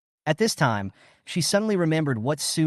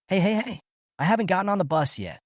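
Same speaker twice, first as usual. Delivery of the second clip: higher, fast call after the car; 8 semitones higher, faster than usual. Acted. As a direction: shout after the leaving car. Calling after departing car.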